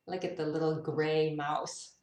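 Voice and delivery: ordinary delivery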